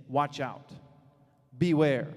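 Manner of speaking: "Watch out" set apart, then "Beware" punched loud; emphatic warning. Shown as a demonstration. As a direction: land "Watch out. Beware." as separate warnings, hardest on "Beware". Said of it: "did you catch the emphasis there".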